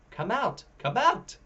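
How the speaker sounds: high, rising playful call; sing-song call across the orchard. A narrator's reading. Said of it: "Alabaster calls".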